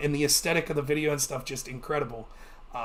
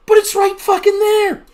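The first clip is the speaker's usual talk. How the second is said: high and loud, nearly yelling; excited shout; 13 semitones higher, 11 dB louder than usual. Retold or imitated. To friends watching the game, a high excited yell. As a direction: make them look at the deer now. Pointing out the deer.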